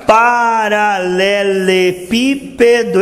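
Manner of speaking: very slow "paralelepípedo", long held syllables. Shown as a demonstration. Preacher repeating his Portuguese example, syllable by syllable "paralelepípedo". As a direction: draw out "paralelepípedo" to the extreme, syllable after syllable. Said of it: "Eu vou dizer de novo agora".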